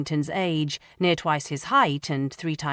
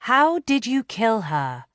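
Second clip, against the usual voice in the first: slow, deliberate, higher question; slow, careful and clear; 7 semitones higher, 4 dB louder than usual. Acted. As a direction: spell it out slowly for him. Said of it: "speaking slowly and clearly".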